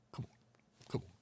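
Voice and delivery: low ghostly whisper; Paul Mooney impression. Paul Mooney's ghost urging Tim, whispered. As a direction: breathe it out softly. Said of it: "Paul Mooney's voice".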